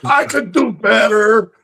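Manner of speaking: high, drawn-out mock heckle; mildly yelled impression of a heckler; imitated yelling. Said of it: "Bobo's just yelling shit".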